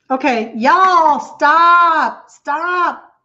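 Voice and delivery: laugh-cry protest at kind viewers; embarrassed emotional stop; teary plea. Real, not acted. Laughing off her own tears.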